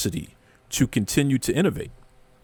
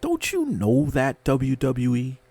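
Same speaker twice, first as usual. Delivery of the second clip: ordinary talk, nothing marked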